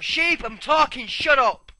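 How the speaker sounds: sudden high, very loud shout; exasperated yell. Real, not acted. Gamer to a bleating sheep interrupting him, exasperated yell. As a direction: yell over the interruption to silence it.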